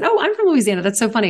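high, bright surprise at a shared hometown; delighted surprise. Retold or imitated. Telling a stranger she is also from Louisiana, surprised and delighted. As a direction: squeal it like finding a fellow Southerner. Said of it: "And I said".

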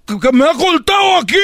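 high-pitched protest of an injured customer; pained complaint. Acted. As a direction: complain as if just nicked. Cut customer complaining to father. (queja aguda de cliente herido)

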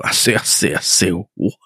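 mock the admiral's Southern bluster; hammed-up Southern accent; Southern gentleman. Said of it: "that Southern gentleman".

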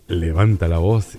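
low, slow radio voice; mock announcer. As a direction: give a pompous deep-voiced tip. Mock announcer lesson for aspiring broadcasters.

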